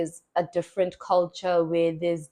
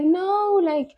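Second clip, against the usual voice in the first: higher, earnest questioning voice; earnest church leader; 10 semitones higher, 3 dB louder than usual. Retold or imitated. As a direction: imitate the church leader pressing gently.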